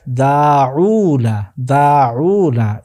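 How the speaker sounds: দাউনা very slowly, daa-uu-na with both long vowels held; drawn-out teacher's model of দাউনা; careful pronunciation demo. Shown as a demonstration. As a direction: stretch দাউনা out for students to notice. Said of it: "এই উচ্চারণটা আপনি ভালোভাবে লক্ষ্য করা চাই".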